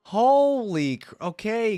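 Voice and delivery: shocked outburst. Real, not acted. Reacting to gruesome voice lines.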